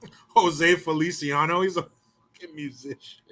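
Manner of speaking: usual speaking voice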